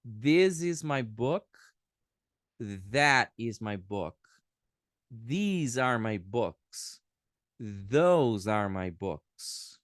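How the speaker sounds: very slow, deliberate example sentences; teacher reading examples slowly; slow model reading. Shown as a demonstration. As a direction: read each sentence word by word for learners. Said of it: "se eu fosse ler aqui o exemplo".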